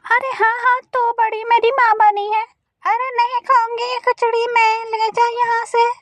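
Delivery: grumpy mother-in-law crow. Acted. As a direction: whine it like a stubborn old mother-in-law. (चिड़चिड़ी सास की आवाज़)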